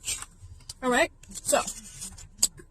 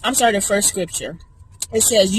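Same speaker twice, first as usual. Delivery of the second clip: ordinary delivery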